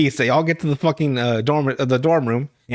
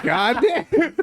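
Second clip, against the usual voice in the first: laughing disbelief; 8 semitones higher than usual. Real, not acted. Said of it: "And I'm like".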